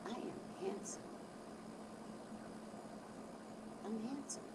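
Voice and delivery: hushed, unhurried affirmation; soft self-affirmation. Shown as a demonstration. Speaker modelling morning self-love, hushed and sincere. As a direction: say it very softly to yourself.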